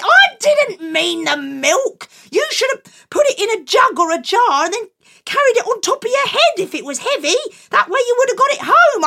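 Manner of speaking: high, loud exasperated scolding; despairing, fussing mum. Acted. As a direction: mum scolding her silly son.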